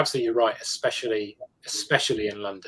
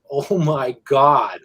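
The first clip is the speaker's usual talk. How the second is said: loud, drawn-out, wide-open American; over-the-top American accent; 4 semitones higher, 10 dB louder, much slower than usual. Shown as a demonstration. British actor mimicking Americans to colleagues, over-the-top American accent. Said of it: "you do an over the top American".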